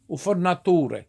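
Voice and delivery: Italian-American "foor-na-too-reh"; English word turned Italian; Italian accent. Shown as a demonstration. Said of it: "saying furniture with an Italian accent".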